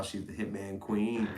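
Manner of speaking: usual speaking voice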